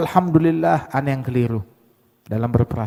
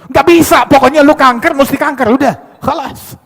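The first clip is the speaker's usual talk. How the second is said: high, loud stubborn denial; stubborn insistence; 10 semitones higher, 10 dB louder than usual. Retold or imitated. Deluded doctor shouting patient must have cancer, loud and high. Doctor refusing good result.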